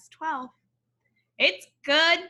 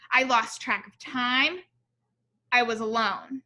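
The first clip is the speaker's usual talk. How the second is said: story narration; slower than usual. A narrator's reading. Reads picture book aloud.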